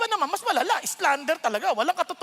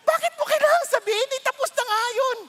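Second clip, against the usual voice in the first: higher, louder pleading; anguished plea; 11 semitones higher, 5 dB louder than usual. Real, not acted. Pastor pleading with church against gossip, anguished. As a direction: cry out why.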